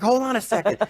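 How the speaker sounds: high mock brush-off; dismissive husband. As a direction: toss it back like the husband busy with the dog. Husband brushing off his wife.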